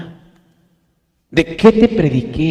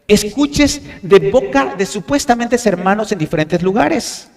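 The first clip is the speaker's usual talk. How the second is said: ordinary talk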